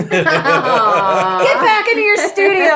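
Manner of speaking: loud, slow, laughing yell; retold yell. Yelling at the nosy studio neighbor, slow laughing yell. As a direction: bark it out slow while half laughing. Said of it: "I start yelling at him".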